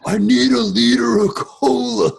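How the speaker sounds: loud, high, drawn-out film quote; Farva. Retold or imitated. Quoting Super Troopers to friends, loud hammed-up impression. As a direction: bellow it slowly as an impression. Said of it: "thinking of Farva from Super Troopers".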